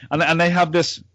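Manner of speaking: ordinary talk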